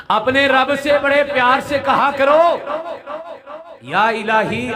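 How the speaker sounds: high loud plea; fervent appeal. Real, not acted. Preacher urging listeners to pray lovingly, loud high plea. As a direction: lift it up high, pleading.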